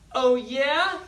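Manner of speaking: put-on high, loud boyish challenge; Jared's challenge. A narrator's reading. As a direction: toss it back like a bold kid. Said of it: "he said".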